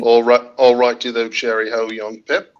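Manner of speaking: hammed-up British greeting; mock British toff's hello; British accent. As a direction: put on a jolly British accent. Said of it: "you've happily come in and done British".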